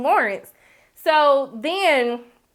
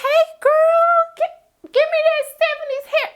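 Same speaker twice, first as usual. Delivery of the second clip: much higher, hammed-up girly squeal; gushing girlfriend voice; 4 dB louder than usual. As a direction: play the over-excited friend greeting her girl. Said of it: "I was like".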